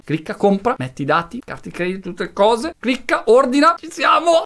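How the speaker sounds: building to a high, loud shout; excited. Acted. Cheering buyer through checkout.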